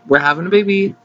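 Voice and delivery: excited. Pretend. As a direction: burst out the news the second time. Announcement repeated louder.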